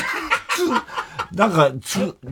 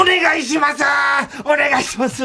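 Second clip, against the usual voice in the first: mock shouted plea; begging shout; 10 semitones higher than usual. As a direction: yell it at the audience like a desperate comic. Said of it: "って、あいつは".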